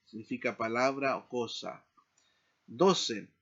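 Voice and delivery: ordinary delivery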